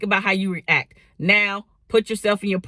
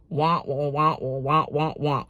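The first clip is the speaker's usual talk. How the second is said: low muffled trombone-like wah wah; muted horn wah wah, lower and softer; Charlie Brown teacher wah; 4 semitones lower, 3 dB quieter than usual. Retold or imitated. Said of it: "sounds like this".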